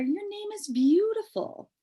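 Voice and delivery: high, tender reassurance; loving reassurance. Acted. Mother reassuring sad daughter, high and tender. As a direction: comfort her daughter. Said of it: "said her mother".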